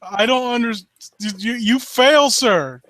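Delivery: ordinary talk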